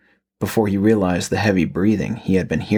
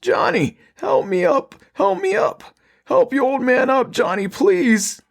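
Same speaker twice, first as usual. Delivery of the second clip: much higher and slow, creature begging its son; raspy pleading. Acted. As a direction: drag out a monstrous plea for help. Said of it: "he pleaded".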